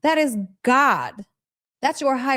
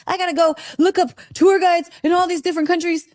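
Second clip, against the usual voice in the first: mock panic; 6 semitones higher, faster than usual. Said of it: "It's not like I was like".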